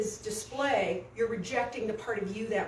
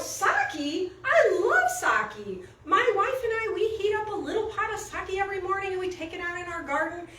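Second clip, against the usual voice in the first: high, delighted lilt, louder; friendly old man; 8 semitones higher, 3 dB louder than usual. Retold or imitated. Old man winning over the hateful drunk, friendly. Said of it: "he's all friendly and everything".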